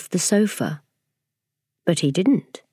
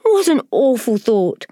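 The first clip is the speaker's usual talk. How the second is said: higher, louder, amused; amused tutting; 7 semitones higher, 4 dB louder than usual. Acted. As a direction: scoff at the idea, amused. Amused creatures reply.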